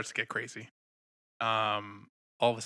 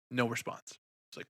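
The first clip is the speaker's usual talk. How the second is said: ordinary delivery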